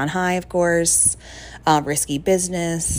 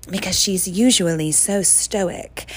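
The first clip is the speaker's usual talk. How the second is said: ordinary talk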